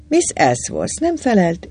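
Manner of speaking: ordinary delivery, nothing marked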